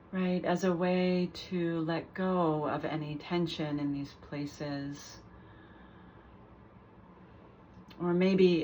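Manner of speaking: calm guided meditation with long pauses between phrases; guided body scan. Real, not acted. Teacher guiding listeners through a mindful body scan, unhurried meditation voice. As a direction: lead them gently through the body, leaving space after each phrase.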